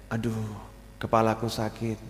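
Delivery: soft, fussing wife asking for pity; pampered wife. Acted. Wife telling husband her head hurts, softly pampered. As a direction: moan a little to be babied by him. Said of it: "ngalem, manja".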